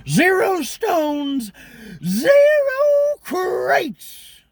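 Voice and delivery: high, very slow, drawn-out villain voice; Zorg impression. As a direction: stretch each word like a smug movie villain. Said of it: "I love Gary Oldman in that role".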